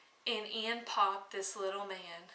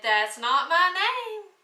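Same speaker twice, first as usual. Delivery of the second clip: higher, louder gloating little man; little man's taunt; 7 semitones higher, 8 dB louder than usual. Acted. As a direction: crow it back at the queen, pleased with himself. Little man refusing each guess.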